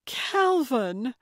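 high, joyful cry of the name; delighted greeting. A narrator's reading. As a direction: greet a long-lost friend with delight. Delighted greeting, face broke into smile.

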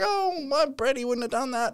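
put-on high, soft little old lady voice; imitating a doting grandma; sweet old nan. Grandma defending her grandson in court, high and soft. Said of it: "Nan was this sweet old lady".

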